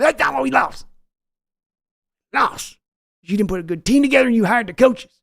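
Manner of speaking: high, loud bellow of an angry team owner; Jerry Jones yelling. Retold or imitated. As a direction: yell for your son like a furious boss. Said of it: "your Jerry Jones voice yelling at Steven".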